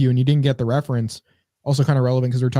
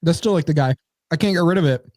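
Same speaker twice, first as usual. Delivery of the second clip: ordinary talk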